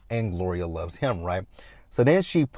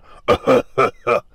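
loud mock hacking coughs; 2 semitones higher, 8 dB louder than usual. Retelling Walter hacking up his lungs, loud imitated coughing.

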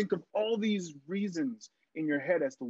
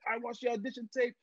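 much higher, off-key attempt at a Mexican accent that drifts French; butchered Mexican accent; 9 semitones higher than usual. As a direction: gush his praise in a botched Mexican accent. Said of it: "I'm gonna butcher his accent".

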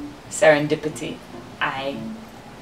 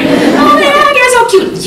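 put-on very high squealing coo at a baby; baby talk; 9 dB louder than usual. Mom cooing at her cute baby, high and gushing. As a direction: gush over the baby in a sky-high voice.

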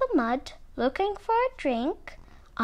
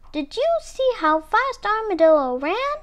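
high-pitched, only slightly louder exclamation; mild shout; 8 semitones higher, 3 dB louder than usual. A narrator's reading. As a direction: call it out in raised pitch. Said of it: "Muskrat shouted".

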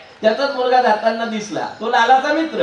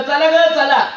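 eager calling out; 5 semitones higher, 5 dB louder, slower than usual. Acted. Gathering the village women.

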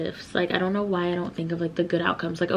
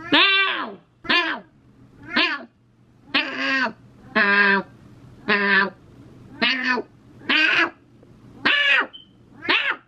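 high playful meowing; cat meows; 6 semitones higher, much slower than usual. Pretend. Joking around on camera, meowing like a cat. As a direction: meow like a kitten, up high.

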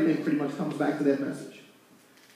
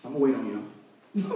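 normal talking voice

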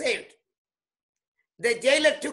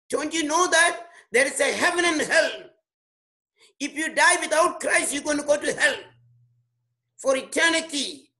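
fiery preaching; 2 semitones higher than usual. Real, not acted. Preaching at full force.

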